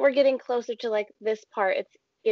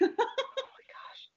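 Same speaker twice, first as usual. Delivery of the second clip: breathless whispered laugh; 13 semitones higher, 8 dB quieter, much slower than usual. Real, not acted. Laughing at shaking house.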